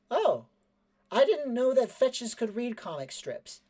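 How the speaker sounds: high-pitched innocent surprise; surprised boy character. Acted. Cleven surprised about fetches, high boyish voice. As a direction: say it high, as a puzzled kid.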